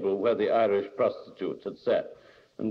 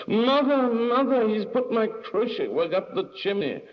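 high, loud tattling wail; sister's scream; 12 semitones higher, 3 dB louder than usual. A narrator's reading. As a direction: shriek for mother in a girl's complaint. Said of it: "he heard his sister scream".

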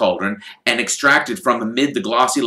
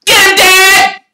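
much higher, louder cheering yell; 13 dB louder, slower than usual. Acted. Twins cheering their father in a brawl, yelling. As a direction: egg your father on at full voice. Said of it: "There was a yell of".

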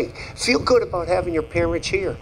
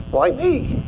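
mock surprised why me; 10 semitones higher than usual. Retold first reaction to invitation.

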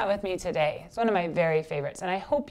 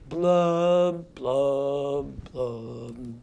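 drawn-out blubs, each lower; fish's blub; 4 semitones lower, much slower than usual. Shown as a demonstration. Teacher shows children the easy part, descending fish blubs. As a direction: blub slowly down in steps like a fish.

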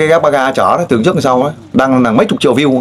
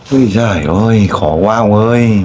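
than thở kéo dài; 3 semitones lower than usual. Real, not acted. Host laments husband's situation.

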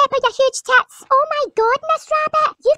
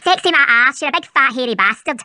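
deep, growling Scottish mum; gruff low mother voice; 11 semitones lower than usual. Acted.

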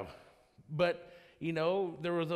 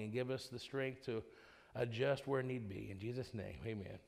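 prayer; 4 semitones lower, 5 dB quieter than usual. Real, not acted. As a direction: pray quietly and earnestly. Leads the congregation in prayer.

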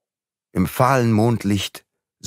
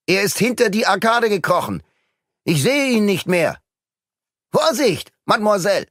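high, raised warning call; 12 semitones higher than usual. Acted. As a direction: call out from a window. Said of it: "Er rief ihr vom Fenster aus zu".

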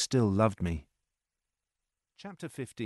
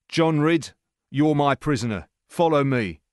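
louder, raised-voice order; said loudly; 5 semitones higher, 6 dB louder than usual. A narrator's reading. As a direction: announce it for the soldiers to hear. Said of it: "Jeremy said loudly".

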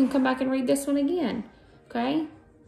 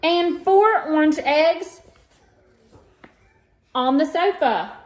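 put-on reading to children; 7 semitones higher, slower than usual. Teacher reading an Easter book to her students, lively storytime voice. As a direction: read it like storytime for little ones. Reads picture book aloud.

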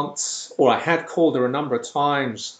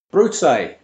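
put-on aunt's Spanish-accented brute, louder; 5 semitones higher, 8 dB louder than usual. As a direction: say brute the Spanish way, with a final e, as the aunt. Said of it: "English, Spanish accent there".

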